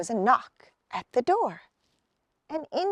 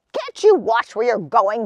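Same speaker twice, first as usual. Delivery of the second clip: louder, cross gnome voice; grumbling gnome; 8 dB louder than usual. Acted. Gnomes scolding the boys who crashed in, grumbling. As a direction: scold the clumsy visitors. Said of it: "the gnomes grumbled".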